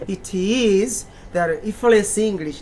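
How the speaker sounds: normal talking voice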